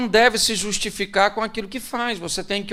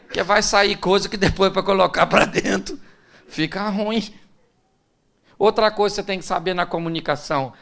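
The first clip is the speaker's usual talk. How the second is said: ordinary delivery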